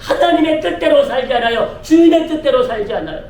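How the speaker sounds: rising, driving sermon delivery; forceful preaching. Real, not acted. Pastor telling the congregation to live by God's will, forceful preaching. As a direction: drive it toward a ringing declaration of faith.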